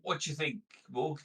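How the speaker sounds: ordinary delivery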